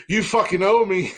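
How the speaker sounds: high, loud mock grumble; gruff Pops voice. Pops heckling from background.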